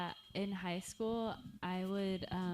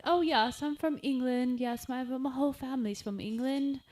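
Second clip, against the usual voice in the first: loud, higher put-on British accent; hammed-up British, pitched up; 6 semitones higher, 7 dB louder than usual. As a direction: brag in a fake British accent. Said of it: "talk in a British accent".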